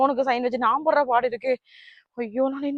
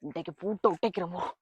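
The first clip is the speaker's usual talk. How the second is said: sneaking into the house to catch him; sneaky hush; 7 semitones higher, 16 dB quieter than usual. Acted. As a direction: keep it hushed as you creep in. Sneaking in to break the lock.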